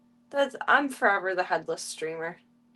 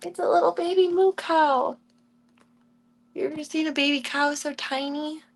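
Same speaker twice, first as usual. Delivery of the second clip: cooing pet talk; 5 semitones higher than usual. Real, not acted. Streamer gushing over little baby cow, high cooing.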